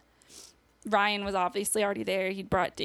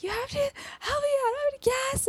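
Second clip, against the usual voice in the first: much higher, panicked plea; reliving a desperate cry for help; retold panicked scream; faster than usual. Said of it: "And I was screaming".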